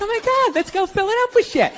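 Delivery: high mock woman's thrilled squeal; a hammed-up excited girl. Woman seizing an open day with a horrible idea, hammed-up and high. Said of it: "They're like".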